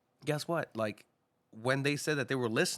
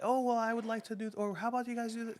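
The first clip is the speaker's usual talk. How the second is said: much higher, put-on pleading voice; meek suggestion; 11 semitones higher than usual. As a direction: pipe up high, like a hopeful latecomer. Acting out a meek latecomer's suggestion.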